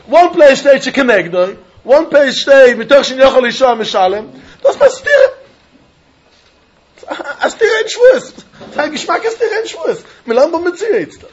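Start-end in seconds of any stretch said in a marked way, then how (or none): 4.6-10.0 excited laughing exclaim. Real, not acted. Excited point about a contradiction.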